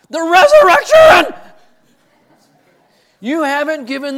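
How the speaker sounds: stern professor yelling at a failing student; professor's yell. Retold or imitated. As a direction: bellow the correction at the student. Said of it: "And he yells at me".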